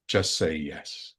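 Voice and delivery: slow, lightly voiced inner guide; angel's voice. Angel to the hesitating husband, inner voice. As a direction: imitate a calm directive from within, slowly. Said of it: "heard my angel's voice in the back of my head".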